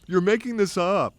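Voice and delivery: imitating a rattled friend yelling; mock bellow. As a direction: yell at the prankster friend. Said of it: "They'd bellow out things like".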